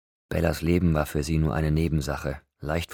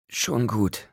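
higher voice, short of breath; strained, shallow breathing; 6 semitones higher than usual. A narrator's reading. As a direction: reassure through laboured breathing. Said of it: "während sie immer noch angestrengt und flach atmete". (angestrengt, flach atmend)

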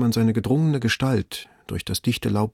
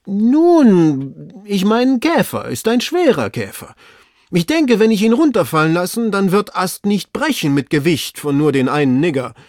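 wheedling servant; 9 semitones higher, 5 dB louder than usual. Acted. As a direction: try to talk the master round. Servant bargains from the tree.

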